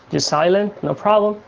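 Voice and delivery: ordinary talk, nothing marked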